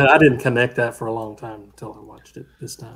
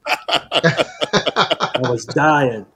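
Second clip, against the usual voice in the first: laughing; much slower than usual. Real, not acted. Laughing at the scene.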